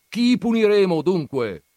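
shouted. Acted. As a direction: bellow the question at the room. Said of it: "gridò". (il nonno grida alla famiglia, molto più alto e forte)

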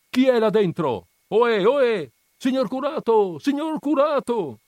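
loud, very high calls climbing; calling up to a window. Acted. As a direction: hail the priest from the street below. Crowd calling to priest's window.

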